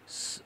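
"স" as a pure hiss; isolated unvoiced hiss for "স"; s sound demo. Shown as a demonstration. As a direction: hiss "স" with no vowel after it. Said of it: "এরকম হবে".

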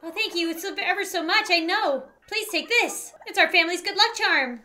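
high, grateful character voice; Kirby the mayor. Acted. Mayor handing over the lucky charm, high grateful voice. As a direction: gush thanks as a cute mayor.

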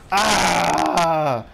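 loud, sustained "ah"; excited scream. Real, not acted. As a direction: yell long as the aerial comes off. Yell as the shot goes.

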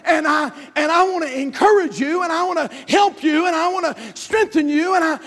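high, loud preacher shout; preaching at full force. Acted. Pastor urging failed folks to rebound, preaching at full force. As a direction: preach it out to the congregation.

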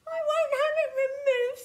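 very high, loud, fast wail; mock sobbing protest in a high squeal; tearful tantrum, pitched right up; sobbed refusal. A narrator's reading. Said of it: "sobbed Tiny".

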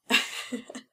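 short breathy sneering chuckle; mocking laugh. Captor laughing at the pleading brother, mocking laugh. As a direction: laugh at his begging. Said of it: "Tim schüttelte lachend den Kopf".

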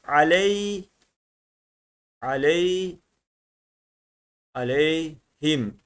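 "aley" drawn out slowly, gliding onto the y; cezimli okunuş. Shown as a demonstration. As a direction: stretch "aleyhim" syllable by syllable, a-ley-him. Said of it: "cezimi koyuyorum buraya". (cezimli y'yi uzatarak, yavaşça)

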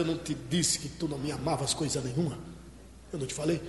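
low, quiet acted scolding; hushed, low-pitched reproach. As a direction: drop the voice for a private telling-off. Imagined reproach from Jesus to Peter.

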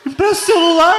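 high, loud demand; agitated request. Acted. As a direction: ask for it urgently, pitched high. [name]'s acted request after pause.

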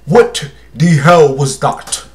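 slow, put-on African accent. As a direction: drag out each word in a mock African accent. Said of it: "In my African voice".

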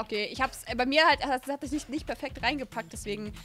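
ordinary delivery

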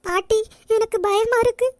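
high, loud tearful plea; crying like a child. Acted. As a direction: wail to grandmother for comfort. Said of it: "சின்ன பிள்ளை மாதிரி அழுதுகிட்டு".

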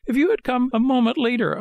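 very high, frightened puppet voice; trembling with fright. A narrator's reading. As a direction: relive the near escape in high-pitched fright. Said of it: "still trembling with fright".

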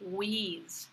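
"wheeze" as the long, stressed end of Louise; stressed second syllable. Shown as a demonstration. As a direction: draw out "wheeze" as one relaxed syllable. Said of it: "stress on the second syllable".

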